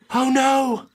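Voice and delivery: put-on panicked old wife wringing her hands; worried old mama. Old wife to her husband about the rooms, fretting. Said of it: "She says".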